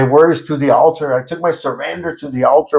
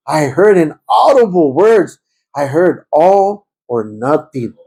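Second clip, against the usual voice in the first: slow, weighty; slower than usual. Real, not acted. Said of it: "slowing to stress the words".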